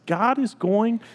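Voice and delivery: ordinary talk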